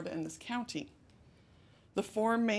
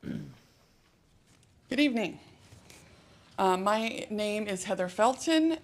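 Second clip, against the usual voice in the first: loud, projecting classroom voice; teacher voice; 5 dB louder, slower than usual. Real, not acted. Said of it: "I'm sorry I'm using my teacher voice".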